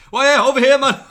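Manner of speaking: high, loud Geordie shout; Geordie accent. Retold or imitated. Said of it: "a Geordie accent".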